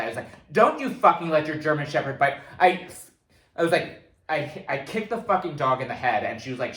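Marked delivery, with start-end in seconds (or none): 0.5-2.4 loud, raised retelling of a furious warning; retold scream. Dog owner yelling at the German Shepherd's owner, retold scream. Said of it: "I screamed at that".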